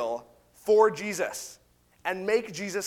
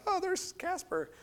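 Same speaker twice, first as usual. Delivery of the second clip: high, softer comic alarm; a small high squeak of pretend fright; mock freaked-out; 11 semitones higher, 8 dB quieter than usual. Disciples mistake Jesus for a ghost, mock fright. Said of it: "freaked out".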